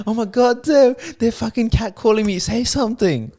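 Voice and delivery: put-on high, whiny girlfriend demanding action; high mock girlfriend voice. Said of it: "I'm like, imagine I was your girl".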